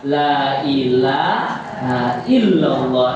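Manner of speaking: very slow, drawn out recitation; slowly. Shown as a demonstration. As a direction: stretch every syllable gently. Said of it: "Pelan-pelan sambil mengucapkan".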